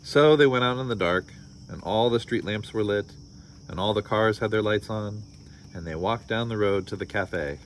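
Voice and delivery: storybook read-aloud with voiced characters; story narration. A narrator's reading. Parent reading a picture book to listeners, lively storytelling. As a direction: read it like bedtime story time.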